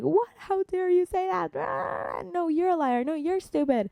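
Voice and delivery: high-pitched mock tantrum with a strangled squeal; hammed-up, squeaky outrage; throwing a fit. Acting out someone hitting back at an insult, mock tantrum. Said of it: "also throw a fit".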